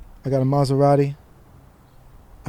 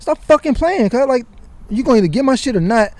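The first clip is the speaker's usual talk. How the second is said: angry demand; 9 semitones higher than usual. Retold or imitated. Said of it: "I just snapped".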